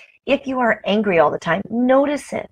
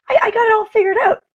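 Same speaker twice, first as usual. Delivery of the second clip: high, fast, louder gushing; excited boast; 13 semitones higher, 4 dB louder, faster than usual. Real, not acted. Telling viewers about her lottery plans, excited and high. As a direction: bubble over with confidence. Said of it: "excited lotto plan".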